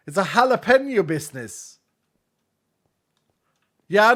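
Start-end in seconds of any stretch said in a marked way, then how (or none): 0.2-1.1 "jalapeno" said as hala-PEN-yo; deliberately odd jalapeno. Shown as a demonstration. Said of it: "hates me for saying jalapeno like this".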